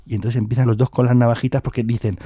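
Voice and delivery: ordinary delivery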